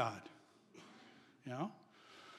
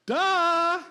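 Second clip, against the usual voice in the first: 1 mock duh; 11 semitones higher, 8 dB louder than usual. Mocking the doubters' request.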